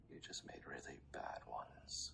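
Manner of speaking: soft unvoiced whisper; menacing whisper. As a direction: purr it with threat.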